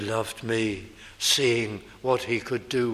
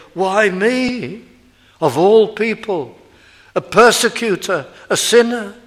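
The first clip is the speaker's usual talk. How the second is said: loud, higher cry of wonder; Paul's outcry; 9 semitones higher, 9 dB louder than usual. Retold or imitated. Preacher voicing Paul's amazement, loud and rising. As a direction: cry out in amazed self-reproach. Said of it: "as though to say".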